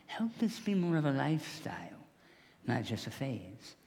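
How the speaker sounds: hushed, private request; softly whispered prayer. Real, not acted. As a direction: whisper the plea to God. Quiet prayer to the Lord.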